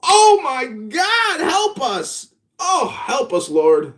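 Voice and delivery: high, drawn-out mock plea; mock despair. Pleading to the Lord over pointless debates, mock despair. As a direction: beseech in hammed-up exasperation.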